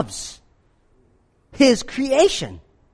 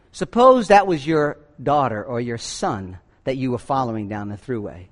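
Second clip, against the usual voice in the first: normal talking voice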